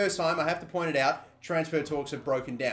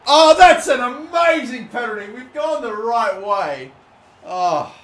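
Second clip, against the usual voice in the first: higher, louder burst of delight; thrilled; 8 semitones higher, 11 dB louder, slower than usual. Real, not acted. Gamer celebrating his penalty, loud thrilled cry. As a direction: cheer your own goal.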